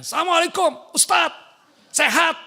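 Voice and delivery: high, loud yelling into the phone; shrill shouted greeting. Shown as a demonstration. A woman phoning the ustadz, yelling her greeting.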